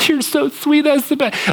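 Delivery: high mock gush; gift lover gushing. Gift-lover thanking whoever bought the gift, in a high mock gush.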